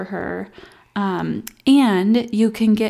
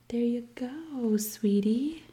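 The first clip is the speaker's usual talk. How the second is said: whisper; 3 semitones higher, 7 dB quieter, slower than usual. Real, not acted. Mom whispering to her baby during feeding. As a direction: breathe it gently, barely voiced.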